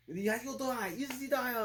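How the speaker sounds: high-pitched panicked terrorist impression; mock panic. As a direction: imitate a terrorist yelping in alarm. Voicing frightened terrorists around the bomb.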